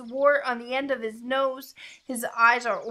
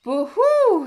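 high, loud owl hoot; startled owl; 10 semitones higher, 9 dB louder than usual. A narrator's reading. Owl sees the Gruffalo, high startled hoot. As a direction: hoot in storybook alarm.

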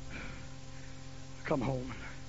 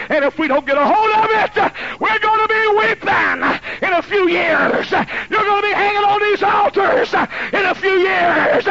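high shouted preaching, slowed and hammered; preaching at full force; 12 semitones higher, 7 dB louder than usual. Acted. Preacher warning parents about raising children, shouted high and slow. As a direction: thunder the warning at the congregation.

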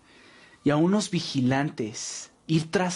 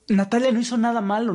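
heated protest; 5 semitones higher, 4 dB louder, faster than usual. Acted. Defying leader, defending Natalia.

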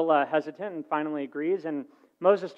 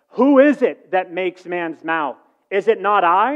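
angry God; 3 semitones higher, 8 dB louder, slower than usual. Acted. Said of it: "God began to get angry with Moses".

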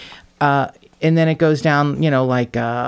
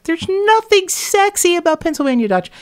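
very high-pitched mock outburst; hammed-up, high-pitched protest; incredulous outburst. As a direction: squawk it in exaggerated disbelief. Exasperated quote of own reaction.

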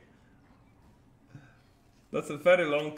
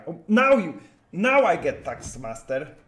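exasperated outburst; 2 semitones higher, 7 dB louder than usual. Real, not acted. Sudden bad draw in game.

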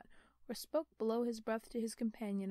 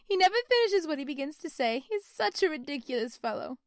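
high, loud teasing woman; amused young wife; 6 semitones higher, 10 dB louder than usual. Acted. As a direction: tease him fondly. Niece laughing at her husband.

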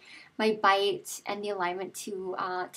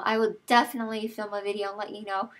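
usual speaking voice

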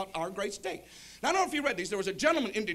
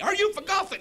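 higher, louder angry repeat; mad goober; 10 semitones higher, 6 dB louder than usual. Acted. Driver losing patience with confused passenger, mad and loud. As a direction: ask it again, irritated, as the Southern driver. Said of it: "Now he's mad at me".

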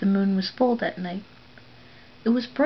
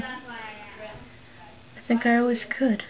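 put-on wondering girl; 4 semitones higher, much slower than usual. A narrator's reading. The silent girl finally speaks.

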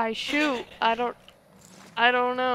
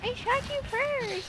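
mocking imitation; 13 semitones higher, 7 dB quieter than usual. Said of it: "That's all you fucking say".

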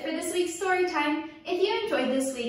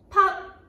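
loud pop; 6 semitones higher, 6 dB louder than usual. A narrator's reading. Balloon bursts on tree.

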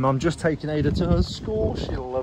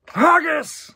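hammed-up Scottish accent, much higher and loud; mock Scottish shout; 14 semitones higher, 8 dB louder than usual. Vlogger joking about a Scottish toy, loud mock Scottish cry. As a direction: yell it like a cartoon Scot. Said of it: "Is that racist?".

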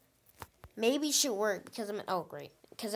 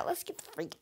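whisper; 6 semitones higher, 10 dB quieter than usual. Real, not acted. Whispers a plan mid-game.

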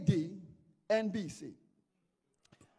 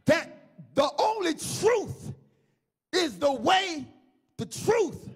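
raised, slow proclamation in separate bursts; preacher voicing God; 6 semitones higher, 3 dB louder, slower than usual. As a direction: swear it solemnly as God, phrase by phrase. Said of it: "God says".

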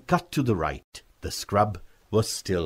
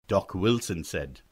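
plain reading voice

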